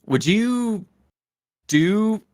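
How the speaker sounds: imitating fans asking. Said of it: "people would ask".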